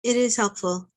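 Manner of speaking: normal talking voice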